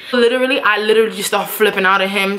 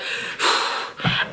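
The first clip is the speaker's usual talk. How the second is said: soft, drawn-out huff; exasperated sigh; 8 dB quieter than usual. Retold or imitated. Two more hours next to boyfriend, exasperated sigh. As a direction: sigh at having to sit beside him. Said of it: "having to sit next to this dude".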